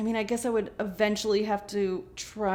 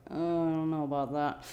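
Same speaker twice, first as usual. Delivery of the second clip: doubtful; 3 semitones lower, 5 dB quieter than usual. Retold or imitated. Voicing shared doubtful look.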